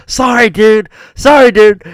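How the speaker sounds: high, loud fake apology; mock apology. Player to the friend he keeps hitting, loud mock apology.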